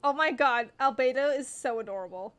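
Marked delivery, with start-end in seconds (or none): none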